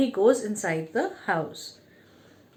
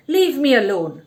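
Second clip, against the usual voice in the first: a louder snarled brush-off; angry snarl; 2 semitones higher, 5 dB louder than usual. A narrator's reading. Father snarls at the boy rushing to him. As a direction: bark it to push him away. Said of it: "He snarled".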